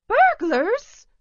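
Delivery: high, loud cry of alarm. A narrator's reading. Mother rushing in with skillet, high alarmed cry. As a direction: shriek the word out in alarm. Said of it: "cried Mamma Little".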